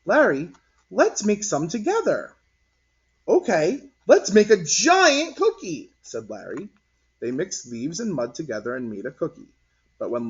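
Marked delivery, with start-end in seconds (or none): 0.0-2.3 put-on louder kid voice; a girl inviting a boy to play; friendly suggestion. A narrator's reading. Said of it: "she suggested". 3.3-5.9 put-on higher, louder, slow kid; a boy excited to play; eager child. A narrator's reading.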